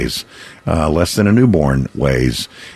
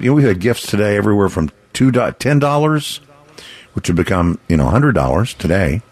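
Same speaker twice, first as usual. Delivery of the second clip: ordinary delivery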